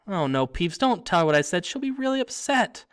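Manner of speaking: urgent, high-pitched pleading; frantic. A narrator's reading. Girl begs the poltergeist not to tell, frantically. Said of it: "Hermione whispered frantically".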